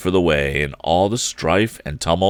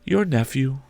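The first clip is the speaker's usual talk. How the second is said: soft, much higher ghostly voice; ghost's voice; 7 semitones higher, 3 dB quieter than usual. Acted. Ghost gently telling Scrooge his sister died, soft and high. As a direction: lift the Ghost's voice up and hush it.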